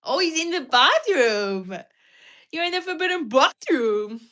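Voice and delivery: loud, drawn-out mock outrage; hammed-up telling-off of a pet; playful scolding. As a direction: raise the voice in pretend alarm.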